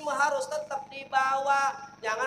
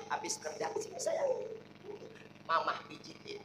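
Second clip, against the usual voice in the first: cooing wife; 10 semitones higher, 8 dB quieter than usual. Acted. Wife coos to tired husband.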